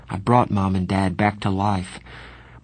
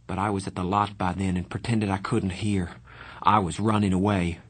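plain reading voice

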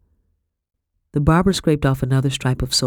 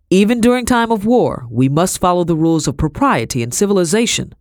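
loud, raised declaration; firm colonel, formal; 4 semitones higher, 5 dB louder than usual. A narrator's reading. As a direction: pronounce the rule as the officer in charge. Voices the colonel.

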